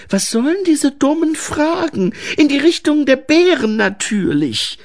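hohe, genervte Mädchenfigur; genervt, abweisend. A narrator's reading. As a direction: fahr den Zwerg ungeduldig und hoch an. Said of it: "der Tonfall ihrer Stimme ließ daran keinen Zweifel". (gereizt und voller Abneigung)

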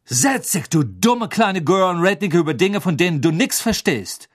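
disdainful rebuke with some force; cool put-down. A narrator's reading. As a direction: put the girl in her place. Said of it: "sagte Rita Kimcorn kühl".

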